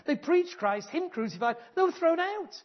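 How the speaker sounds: rising to a loud, high preaching cry; forceful preaching. Real, not acted. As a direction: drive it home from the pulpit. Preaching the apostles' persecution.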